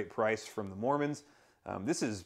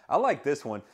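normal talking voice

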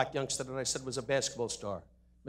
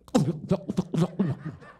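put-on drunken giggle; 4 dB louder than usual. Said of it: "and then went".

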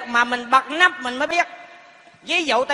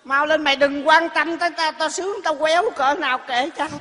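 ordinary delivery

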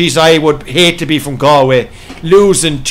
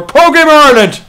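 excited shout-out; 9 semitones higher, 5 dB louder than usual. Real, not acted. Reading out a viewer's name excitedly.

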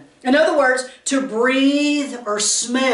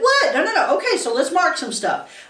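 mock surprise; 6 semitones higher than usual. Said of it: "you're like".

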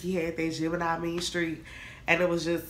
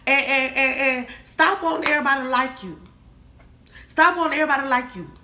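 higher and louder, building to a fast scold; exasperated scolding; 6 semitones higher, 6 dB louder than usual. Real, not acted. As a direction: tell them off, pushing harder. Lecturing Cancers, building.

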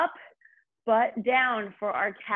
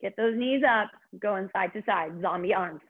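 upbeat workout cueing; aerobics instructor. Acted. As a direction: pump the class up with energy. Fitness instructor cueing cardio.

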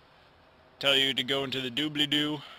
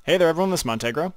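louder upbeat host greeting; final intro take; 3 semitones higher, 8 dB louder, much faster than usual. Shown as a demonstration. Youtuber finally nailing his intro, upbeat. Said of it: "Take three".

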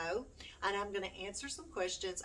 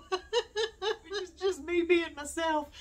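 high, slow words through laughter; laughing; 11 semitones higher, 8 dB louder, much slower than usual. Real, not acted. Vlogger laughing at her own performance. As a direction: laugh while owning it.

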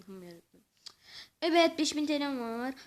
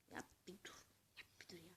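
whispered, much lower, begging; whispered plea; 9 semitones lower, 28 dB quieter, faster than usual. Real, not acted. Gamer whispers to the game, begging it to stop. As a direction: softly beg the game to stop.

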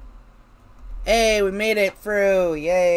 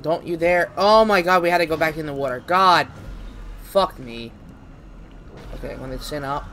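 ordinary delivery

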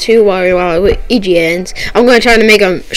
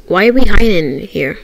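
normal talking voice

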